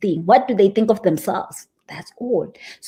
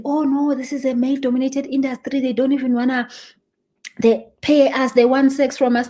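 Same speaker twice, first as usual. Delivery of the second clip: mock complaining; 4 semitones higher than usual. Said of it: "go complaining and say".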